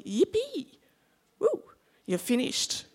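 high, mock-jubilant whoop; playful cheer. Student finally finished studying, high celebratory cheer. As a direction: squeal it like exams are over.

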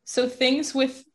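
high, halting, upset voice; retold tearfulness. Telling mom a relationship is done, retold tearful voice. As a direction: imitate breaking bad news through tears, with hesitant pauses. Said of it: "that I'm crying".

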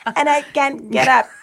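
high, slow helpless granny cry; old lady commercial voice. Retold or imitated. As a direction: ham up the famous TV ad plea. Quoting the Life Alert commercial.

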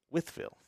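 Withville said like the word with; Withville pronunciation. Shown as a demonstration. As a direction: say Withville with a short I. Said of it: "Like with".

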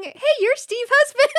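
high, slow excited fan voice; lightly playing an eager fan; quoting a fan. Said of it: "people coming".

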